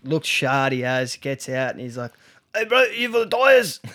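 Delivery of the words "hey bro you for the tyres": high, put-on voice of a bloke who can't speak properly; mocking a drugged-up seller's voice; mock dodgy bloke. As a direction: impersonate the limping tyre guy, pitched way up. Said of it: "couldn't actually speak properly".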